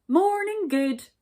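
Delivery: loud, high, bright greeting; cheerful. A narrator's reading. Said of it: "he said cheerfully".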